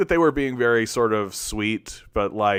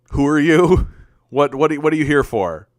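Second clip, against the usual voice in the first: higher, louder imitation of a suspicious questioner; mock incredulous challenge; 4 semitones higher, 6 dB louder than usual. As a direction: challenge a newcomer as if they don't belong.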